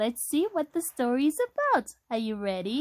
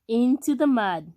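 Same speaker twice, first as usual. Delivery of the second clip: plain reading voice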